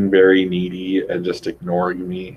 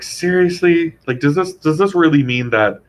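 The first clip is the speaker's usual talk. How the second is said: incredulous; 8 semitones higher, 3 dB louder than usual. Acted. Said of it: "I was like".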